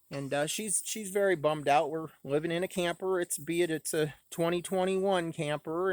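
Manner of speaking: ordinary delivery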